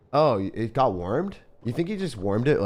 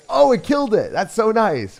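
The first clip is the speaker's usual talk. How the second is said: much higher and louder; surprised delight; 12 semitones higher, 8 dB louder than usual. Real, not acted. Streamer surprised and thrilled that his unit got the kill. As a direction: burst out happily surprised.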